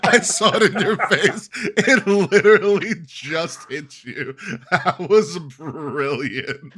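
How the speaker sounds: talking through real laughter; high, slow, laughing it out. Real, not acted. Cracking up at the stoned co-host.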